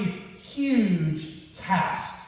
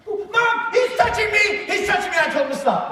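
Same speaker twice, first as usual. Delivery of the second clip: much higher whining tattle; whiny tattling little sibling; 13 semitones higher, 3 dB louder than usual. Retold or imitated. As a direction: cry out to Mom, whining. Sister tattling to mom.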